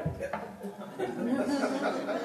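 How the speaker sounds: high airy giggle after the joke; soft chuckling laugh; breathy laugh. Real, not acted. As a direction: let out a light amused laugh. Laughs at his own joke.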